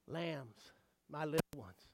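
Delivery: very soft, low, cherishing voice; tender hush. Acted. Jesus speaking of his precious little ones, tender hush.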